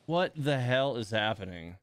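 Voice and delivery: ordinary talk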